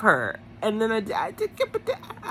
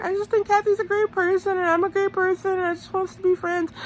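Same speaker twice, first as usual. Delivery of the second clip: high, put-on pleading voice; Teresa; 10 semitones higher than usual. Recapper mimicking a contestant wanting to be friends, high mock pleading. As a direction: plead innocently, pitched way up.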